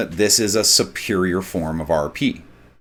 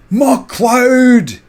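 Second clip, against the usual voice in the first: high, loud Scottish battle cry of MacLeod; Scottish clansman shout; 14 semitones higher, 6 dB louder than usual. Retold or imitated. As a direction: bellow MacLeod like a Highland clansman. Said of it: "they'd say it like".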